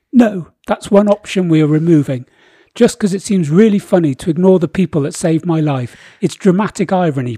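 booming royal decree; pompous king voice. Acted. Boris again.

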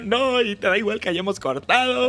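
high-pitched, indignant comeback; retold protest. Boyfriend answering his yelling ex, retold indignation. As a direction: argue back in a mock-yelled quote.

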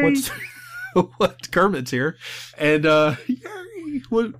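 lightly voiced high puppet squeak; Muppet voice. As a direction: play a hammy puppet. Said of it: "All your Muppets sound the same".

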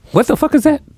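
high disbelieving shock; stunned, voice jumps high. Real, not acted. Shocked at dinosaur picture.

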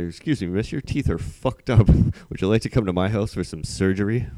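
loud sleazy come-on; creepy fake dentist pickup. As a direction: hit on her like a sleazy dentist. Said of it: "Did he walk up to her and say".

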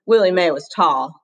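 plain reading voice

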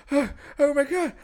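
high, loud, gasping mock fright; mock panic. Imitating wife at the window seeing the monster, mock panic. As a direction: gasp it like a horror-film victim.

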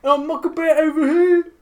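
high, loud mock British chatter; hammed-up British accent. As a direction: parody a Love Island contestant. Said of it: "And she's like".

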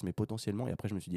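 ordinary delivery

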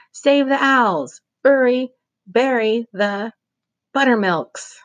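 rally-cry reading; sign slogan. A narrator's reading.

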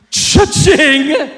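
high, loud cash-register ring; cha-ching. Acted. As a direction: sing out a gleeful register sound. Imitates a cash-register sound.

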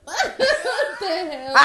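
slow words through laughter; laughing. Real, not acted. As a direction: laugh out the question. Laughing disbelief.

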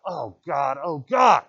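loud, rising anguished cry. Acted. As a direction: torn by indecision, cry out. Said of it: "Ralph cried out".

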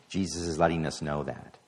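normal talking voice

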